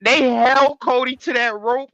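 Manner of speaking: ordinary talk, nothing marked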